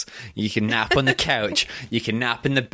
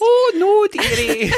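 very high, loud, hammed-up Scottish granny wail; shrieking joke Scottish accent, laughing; exaggerated Scottish; 6 dB louder, slower than usual. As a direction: squeal it like a cartoon Scot. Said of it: "so go more Scottish".

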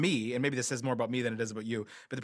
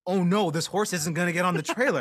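mildly panicked, raised pitch; mock alarm, pitched up; retold panic; 4 semitones higher, 4 dB louder than usual. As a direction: replay a moment of worry. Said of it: "And I, like, panicked".

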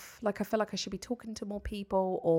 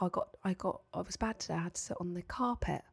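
put-on soft, small voice of an upset little boy; 2 semitones lower, 9 dB quieter than usual. Mum imitating her son's quiet upset confession. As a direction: mimic the son's tiny worried confession.

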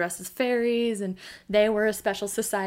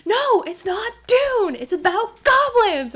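put-on high, loud insistent child; adamant; 12 semitones higher, 8 dB louder, slower than usual. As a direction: insist stubbornly, slowly. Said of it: "I was so adamant".

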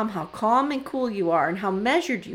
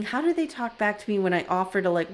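ordinary talk, nothing marked

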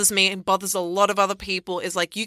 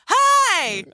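high cheery Kirby greeting; 14 semitones higher, 4 dB louder than usual. Retold or imitated. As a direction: chirp it like Kirby. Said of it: "his voice actor would just go".

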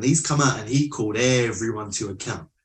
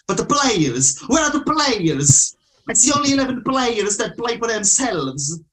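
high, louder outburst of exasperation; impassioned rant; 10 semitones higher, 4 dB louder than usual. Retold or imitated. Manager lays into his selfish players, impassioned high rant. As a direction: re-enact a manager's heated complaint, pitched way up.

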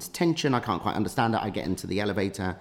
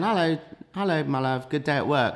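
higher-pitched imitation of a girlfriend; cheery girlfriend greeting; 7 semitones higher, 4 dB louder than usual. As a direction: sing out a welcome home. Voices girlfriend's cheery greeting at home.

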